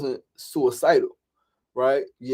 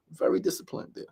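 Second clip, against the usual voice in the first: whispered echo of the line before; soft unvoiced self-praise; 5 semitones higher, 7 dB quieter than usual. Shown as a demonstration. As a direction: whisper it back to yourself as a private aside. Repeats praise, then whispers it.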